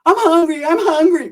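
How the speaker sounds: mock woman's high whine; show-off hunger in a put-on high voice; Sheila. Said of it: "she is acting all brand new".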